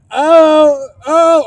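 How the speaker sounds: high, loud exaggerated oohs; playful scandalized whoop; mock shocked reaction.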